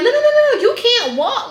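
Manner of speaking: high loud rapid nos; mock alarmed no. Nurse stopping the patient getting up, high loud alarmed nos. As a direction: stop her fast, high and loud.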